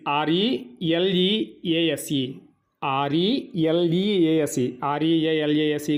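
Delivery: ఆర్ఈఎల్ఈఏఎస్ఈ spelled slowly, each letter apart; slow careful spelling. Shown as a demonstration. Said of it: "చూడండి జాగ్రత్తగా spelling".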